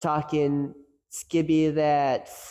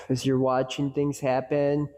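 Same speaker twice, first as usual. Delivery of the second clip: normal talking voice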